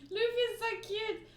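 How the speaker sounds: high, delighted gush; a fan melting over a cute panel. Real, not acted. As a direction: melt over how cute he is. Fan gushing over character.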